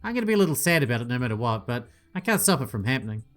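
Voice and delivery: ordinary delivery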